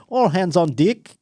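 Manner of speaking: mock New Zealand accent, slow; lightly put-on Kiwi accent, drawn out. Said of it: "a New Zealand accent for two seconds".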